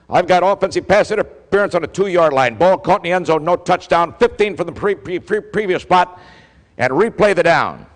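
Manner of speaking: stumbling over "pre-, pre-, previous" in the heat of it; emphatic call. Retold or imitated. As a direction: bark out the ruling right in his face. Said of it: "You're spitting in my face".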